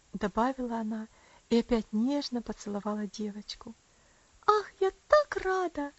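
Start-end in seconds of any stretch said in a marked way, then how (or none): 4.5-5.9 put-on high, loud little-girl delight; joyful exclamation. A narrator's reading. Little girl delighted Rosa likes her papa, exclaiming. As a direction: exclaim happily in a pitched-up child voice. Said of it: "воскликнула маленькая девочка".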